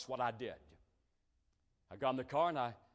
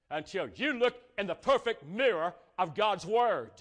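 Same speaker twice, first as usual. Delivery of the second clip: loud, high, driving sermon voice; preaching at full force; 6 semitones higher, 7 dB louder than usual. Acted. Preacher urging church to keep their light clean. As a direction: press the point hard on the flock.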